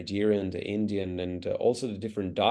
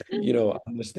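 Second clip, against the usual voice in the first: laughing; 3 dB louder than usual. Real, not acted. Laughing at own clumsy wording.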